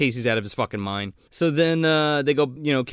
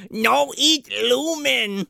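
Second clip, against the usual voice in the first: high, drawn-out creature voice; gnome voice; 10 semitones higher, much slower than usual. Retold or imitated. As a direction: imitate a gnome protesting, slow and high. Imitates gnome's first words.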